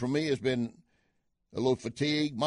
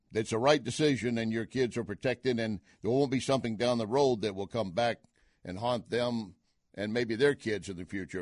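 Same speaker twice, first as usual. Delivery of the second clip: ordinary talk, nothing marked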